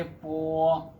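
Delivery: पो drawn out slowly, unaspirated Chinese b; Chinese syllable demo. Shown as a demonstration. Teacher shows how the written syllable is read, slow careful पो. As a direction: model पो slowly and deliberately for students. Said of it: "लेकिन आप पढ़ेंगे कैसे?".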